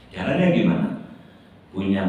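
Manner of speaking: ordinary talk, nothing marked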